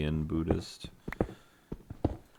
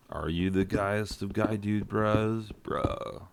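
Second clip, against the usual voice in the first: higher bro voice, trailing into an unvoiced "bro"; put-on guy-dude-bro voice; mock dude bro; 5 semitones higher than usual. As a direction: play a dude bro quizzing his buddy. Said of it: "Hey, guy dude bro".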